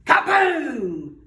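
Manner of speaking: booming sound-effect word; loud kaboom. Acted. Narrator booming the rock's magic blast, loud. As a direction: blast it out like an explosion. Magic rock knocks Anansi down.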